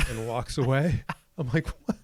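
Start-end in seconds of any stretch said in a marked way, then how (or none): 1.9-2.0 high, loud squeak of disbelief; indignant shock, voice jumping far up; baffled disbelief. Retold or imitated. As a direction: squeal a stunned "what was that". Baffled reaction to clerk.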